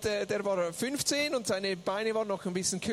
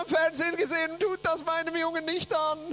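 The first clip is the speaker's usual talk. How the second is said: high wailing imitation; mock tearful protest; 7 semitones higher, 5 dB louder than usual. Interpreter as boy's mother, high tearful protest. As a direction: protest as if weeping. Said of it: "fängt an zu weinen".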